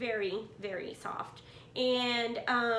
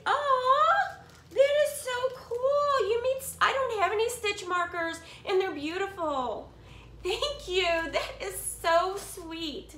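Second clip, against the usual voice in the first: much higher and louder, overjoyed; thrilled gushing; 7 semitones higher, 5 dB louder than usual. Real, not acted. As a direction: squeal thanks for the handmade gift. Handmade stitch markers from friend.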